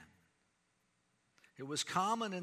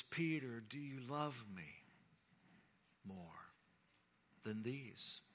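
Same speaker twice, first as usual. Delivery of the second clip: soft, probing, dropping to near silence on more; tender voice of Jesus; 7 dB quieter than usual. Acted. Jesus tenderly questions Peter on the shoreline, softly. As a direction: ask it gently, leaning in close.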